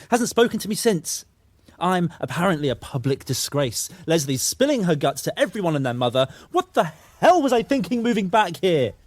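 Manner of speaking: high-pitched, building to a loud outburst; exasperated rant. Acted. Sam ranting about being a public disgrace, exasperated. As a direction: work yourself up to a loud, high finish.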